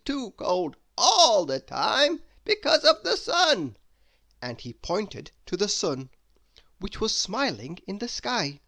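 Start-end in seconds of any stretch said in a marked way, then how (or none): 0.0-3.8 high, loud chuckling wizard; a grand old wizard laughing as he speaks. Acted. Wizard voice in story.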